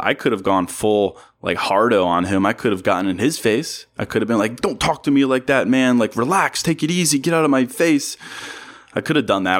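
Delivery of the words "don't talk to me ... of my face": higher, mock tough-guy bluster; fake tough guy. Imagined reply to the driver, mock tough guy. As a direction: puff up like a hardo, half-hearted. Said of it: "fake tough guy act".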